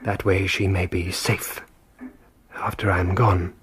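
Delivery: faint dying whisper. Acted. Dying master's faint last wishes.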